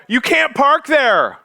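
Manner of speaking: a bystander tossing off a useless remark; mock passer-by. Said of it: "a person drives by and they go".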